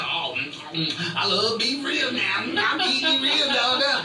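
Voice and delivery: high, smacking rib eater; lip-smacking character voice; Ben voice. Retold or imitated. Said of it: "He said".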